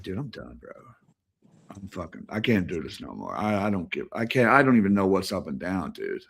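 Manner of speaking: quiet, low, worn out; defeated. Retold or imitated. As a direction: let it sink, exhausted and done. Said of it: "breaking down, retold".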